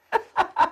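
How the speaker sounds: sudden high laugh; burst of laughter. Real, not acted. Laughs mid speech.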